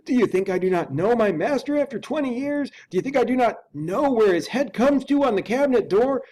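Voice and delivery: the loyal servant insisting hotly; indignant butler. Acted. Butler protests to Utterson.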